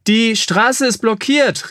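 mother calling from the coach; called out. Acted. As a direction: call back to the family, slow and high. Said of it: "rief seine Mutter Kriemhild vom Kutschbock".

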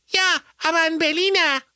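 put-on very high children together; two kids at once, high; in unison. A narrator's reading. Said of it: "wie aus einem Munde".

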